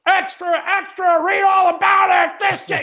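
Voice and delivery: very high, hawking headline cry; old-time newsboy. Acted. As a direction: holler it down the street. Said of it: "you hear someone going".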